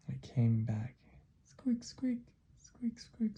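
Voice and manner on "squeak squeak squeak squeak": high, whispery little squeaks; tiny high mouse noise. Acted. Mouse comes back squeaking.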